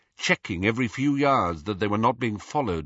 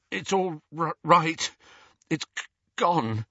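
halting, higher-pitched reassurance; shaken stammer; 6 semitones higher than usual. A narrator's reading. Ron comforting Hermione while shaking, frightened stammer. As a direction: stumble over the words, trembling with fright. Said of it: "who was shaking from head to foot".

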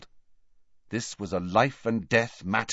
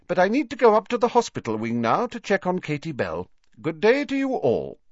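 a hint of brisk stern teacher, higher; lightly voiced stern professor; 10 semitones higher than usual. McGonagall dismissing the students, brisk and stern.

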